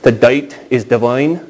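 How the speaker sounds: put-on slow, light Northern Irish accent on "dite". Preacher imitates a Belfast poet, slow mild Northern Irish accent. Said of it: "he speaks with a Northern Irish accent".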